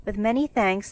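ordinary delivery, nothing marked

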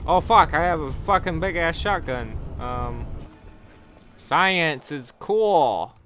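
ordinary talk, nothing marked

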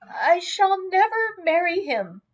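high, quavering refusal; distressed, wavering high voice. A narrator's reading. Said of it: "quavered Lucy".

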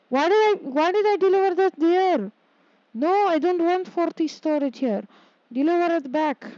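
high-pitched, exasperated protest; exasperated, pitch shooting up; real dismay. Real, not acted. Upset at own mistake in game.

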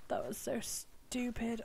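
slow unvoiced whisper. Real, not acted. Player whispering at her own mistake. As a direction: breathe it out in embarrassed whisper. Self-reproach after mistake.

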